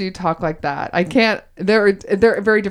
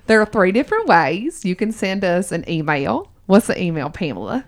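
put-on Southern accent. Host quizzes co-host on their show in a Southern accent. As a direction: quiz her in a playful Southern accent. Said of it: "in my Southern accent".

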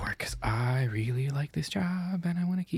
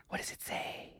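whisper; 14 dB quieter than usual. Acted. Whispered in-character question.

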